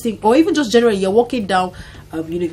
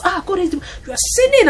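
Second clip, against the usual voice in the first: high-pitched mocking preacher voice; mocking scold; 11 semitones higher than usual. Imitating people who scold a wife wanting divorce, mocking pitch. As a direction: mimic the scolding churchgoers, shrill and mocking. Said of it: "people quoted scripture".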